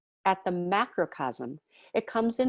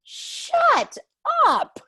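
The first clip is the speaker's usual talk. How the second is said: sudden high, loud Shut, then a louder up; spirit guides' command; 7 dB louder than usual. Retold or imitated. Spirit guides silencing her with a sharp, loud shut up. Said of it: "they're like".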